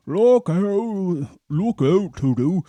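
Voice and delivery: loud high hippo bellow; Humpy Rumpy shouting a warning. A narrator's reading. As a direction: shout to save the children. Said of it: "shouted Humpy Rumpy".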